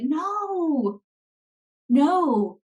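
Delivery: high, drawn-out no; exasperated, stretched-out "no"; emphatic refusal. Real, not acted. Emphatic rebuttal to the quoted complaint.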